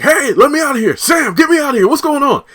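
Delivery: loud, very high mock cries for release; hammed-up frantic yelling. Reviewer acts Kyle yelling to Sam from the truck, frantic and high. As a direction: yell like a trapped man, played for laughs. Acting trapped Kyle calling out.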